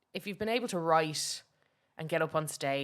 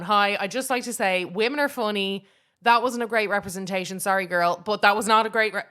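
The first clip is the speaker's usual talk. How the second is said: louder, hammed-up public announcement; mock mic announcement; 2 semitones higher, 7 dB louder than usual. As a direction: grab the mic and address the room, a touch louder. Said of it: "I felt like, like, ripping the microphone".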